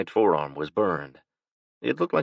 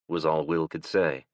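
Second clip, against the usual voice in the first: ordinary talk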